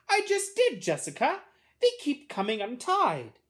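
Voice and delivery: put-on high-pitched little boy, complaining loudly; protesting little brother. A narrator's reading. Boy answering his bossy sister, high-pitched complaint.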